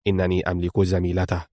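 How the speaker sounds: low, soft, controlled declaration; quietly resolute; firm. A narrator's reading. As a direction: drop the voice and state it firmly. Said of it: "قال بانشو في حزم".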